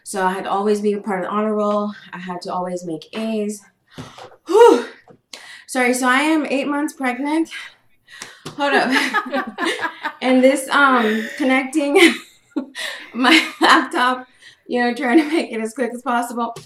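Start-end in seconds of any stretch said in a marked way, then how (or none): 4.5-4.9 high, loud "whew"; out-of-breath whew; winded exhale. Real, not acted. Pregnant guest pauses, winded. 5.7-16.5 winded, pitched up, broken by laughs; out of breath. Real, not acted. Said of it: "catch my breath".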